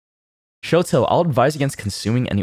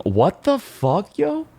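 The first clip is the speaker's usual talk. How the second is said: startled what; 12 semitones higher, slower than usual. Real, not acted. Shocked by a chat comment.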